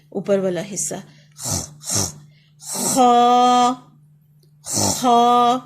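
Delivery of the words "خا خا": خا loud and heavy from the throat, lips unrounded; letter demo. Shown as a demonstration. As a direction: rasp خا out of the upper throat, mouth open. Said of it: "ہونٹ گول نہیں ہوں گے".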